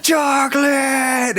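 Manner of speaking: high, long-drawn mock scream; hammed-up high-pitched freak-out yell; crazed scream. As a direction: imitate a cartoon maniac screaming, stretching the word out. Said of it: "he's just screaming that".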